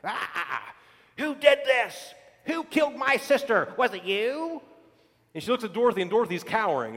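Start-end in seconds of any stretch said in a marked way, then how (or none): 0.0-4.6 shrieking witch voice, higher and louder; furious witch demanding answers; angry witch. Retold or imitated. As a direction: snarl it like the wicked witch. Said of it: "she's fuming mad".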